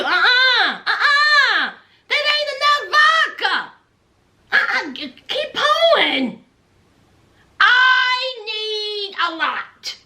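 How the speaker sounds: greedy demand. Retold or imitated. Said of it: "Here's Memphis".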